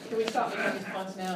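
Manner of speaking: ordinary talk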